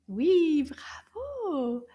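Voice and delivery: ordinary delivery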